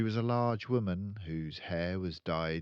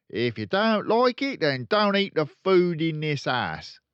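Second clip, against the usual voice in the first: loud, high, blustering dad; angry father; 10 semitones higher, 11 dB louder than usual. Acted. Father snapping at Matilda, loud and angry. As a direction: bark it back at her.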